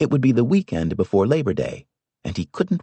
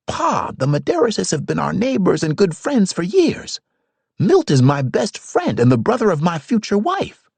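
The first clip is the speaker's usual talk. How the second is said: put-on higher, pleading young man; protesting son; 11 semitones higher, 3 dB louder than usual. A narrator's reading. Son protests to his father about the neighbors, higher and pleading.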